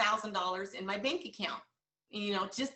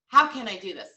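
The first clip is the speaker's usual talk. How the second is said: loud and resolute; loud self-reminder; 11 dB louder than usual. Shown as a demonstration. Coach telling agents to remind themselves, loud and resolute. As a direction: say it like a rallying cry to yourself. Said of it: "remind yourself".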